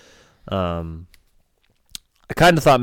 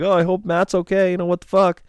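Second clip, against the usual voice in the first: mock worried friends, pitched way up; hammed-up voice for anxious texts; mocking group chat; 10 semitones higher than usual. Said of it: "Like, you're all like".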